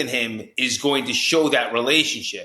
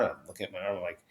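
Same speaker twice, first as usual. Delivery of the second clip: normal talking voice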